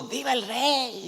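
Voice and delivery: high-pitched mock crowd cheer; celebratory cry without full volume; joyful acclamation. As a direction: imitate a people hailing their king. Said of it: "clamó con alegría".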